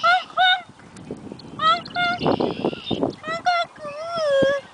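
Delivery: high silly honking call; goose honk. Retold or imitated.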